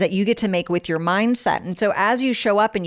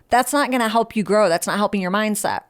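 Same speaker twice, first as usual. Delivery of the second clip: usual speaking voice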